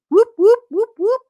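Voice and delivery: short, high, loud whoops; a quick run of high whoops; cheering whoops. Pretend. Co-host cheering a shouted-out listener, high whooping.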